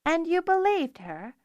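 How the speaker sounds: high, incredulous question; surprised demand. Acted. Taryn challenges Zener, high and surprised. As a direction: demand it in disbelief. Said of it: "Taryn demanded in surprise".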